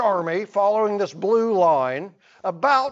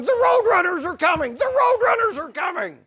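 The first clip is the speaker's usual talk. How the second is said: much higher, fast, louder mock yell; 9 semitones higher, 4 dB louder, faster than usual. Student yelling down the school hall, mock alarm. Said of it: "running down the hall yelling".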